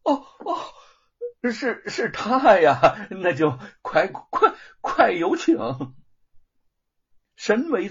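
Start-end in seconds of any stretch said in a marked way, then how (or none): 0.0-5.6 high, slow obsequious change; sudden fawning. Acted. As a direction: switch at once to eager flattery. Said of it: "立时就转变了态度".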